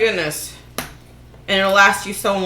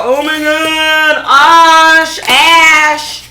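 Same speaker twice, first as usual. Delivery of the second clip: whiny viewer; 5 semitones higher, 5 dB louder, much slower than usual. Pretend. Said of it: "you're gonna go".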